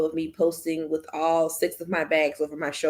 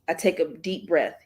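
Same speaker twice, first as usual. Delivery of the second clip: ordinary delivery, nothing marked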